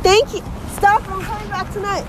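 high, loud shouts on the run; calling out. Real, not acted. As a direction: holler it to be heard outside. Calling out while catching the bus.

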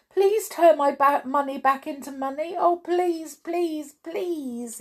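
high-pitched pleading, fading as it repeats; begging. A narrator's reading. Mister Mean pleading for his money back, begging. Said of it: "he begged".

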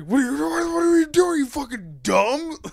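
a father losing it at the wheel; dad yelling. Retold or imitated. Dad screaming at his son stalled at the intersection, frantic high yell.

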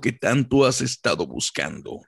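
slow, low announcer voice; dramatic campaign ad narration; political ad announcer. Retold or imitated. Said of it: "Y sale el vato acá".

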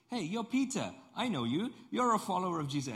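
high, quick call-out; insistent accusation. Acted. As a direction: blurt out that you recognize someone. Third accuser recognizes Peter, acted.

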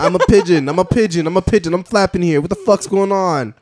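agitated comic Boston voice; Boston pigeon. Acted. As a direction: squawk it in rhythm like a brash Boston bird. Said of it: "Like a Boston pigeon just says".

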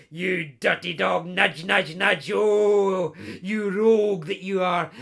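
loud, leering mock ribbing; teasing nudge. Best pal ribbing the laird about his mistress, leering and loud. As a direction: nudge him, you rogue.

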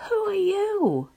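high, loud call across the mountains; curious yell. A narrator's reading. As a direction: yell it out to an unseen stranger. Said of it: "he yells".

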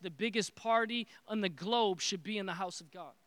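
usual speaking voice

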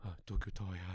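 small voice. Retold or imitated. Imitating Sakamoto quietly marvelling at Tokyo Tower in a small voice. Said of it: "ちっちゃい声で".

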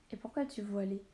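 ordinary delivery

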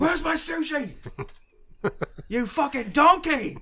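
high, hammed-up kitchen tirade; piercing mock-outraged yell; screaming. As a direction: scream it like a furious TV chef. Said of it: "We scream at each other".

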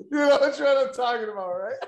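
loud, high, laughing repeat. Real, not acted. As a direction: keep laughing through it. Still laughing at the joke.

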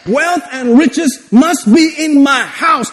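full-force preaching. Acted. Preacher declaring God's promises to congregation, full-force preaching. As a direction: preach it as a rousing claim.

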